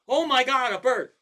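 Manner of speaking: high, loud alarmed cry; really loud. Retold or imitated. Wife spotting a bird, a loud, high alarmed cry. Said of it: "She's like".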